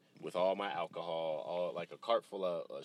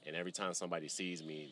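ordinary delivery

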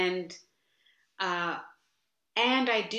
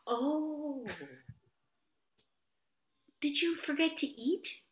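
much higher, theatrical mock concern; dramatic guru; 9 semitones higher, 4 dB quieter than usual. Guru mocks a student who didn't practice, dramatic and high. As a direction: ham up the guru's sarcastic sympathy. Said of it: "He was so dramatic. He'd go".

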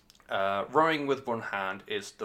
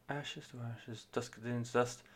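much softer, gentle rendering of the lyric; gentle imitation; 12 dB quieter, slower than usual. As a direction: ease it out softly like the lead singer. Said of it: "vocalist in it is obviously being, like, very gentle".

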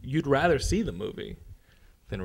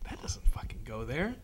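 hushed, breathy muttered complaint; whispered aside; 5 dB quieter than usual. Retold or imitated. Noticing wrong geography in a movie, whispered aside. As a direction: whisper it to yourself in the theater. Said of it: "I'm like".

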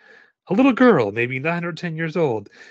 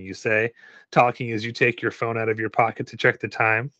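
ordinary delivery

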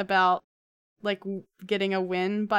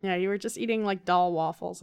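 ordinary talk, nothing marked

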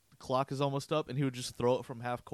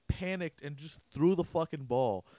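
ordinary talk

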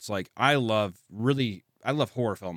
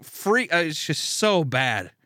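ordinary talk

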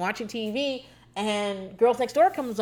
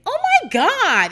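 shocked gasp; 12 semitones higher, 6 dB louder than usual. Retold or imitated. Seeing a girl from home.

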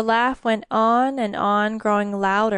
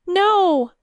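high, loud desperate no; the queen shrieks no as the demon claims her son; desperate cry; 10 semitones higher, 7 dB louder than usual. A narrator's reading. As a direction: scream it to protect the baby. Queen refuses, shielding child.

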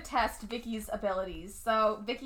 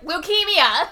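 loud, higher-pitched one-word announcement; abrupt blurt; 5 semitones higher, 9 dB louder, slower than usual. Retold or imitated. Mimicking Grandpa's sudden news to Vicky, abrupt loud blurt. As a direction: blurt it out with no preamble. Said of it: "It's just like".

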